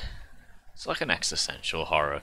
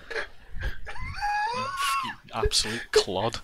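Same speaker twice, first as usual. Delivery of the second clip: mock shout; much slower than usual.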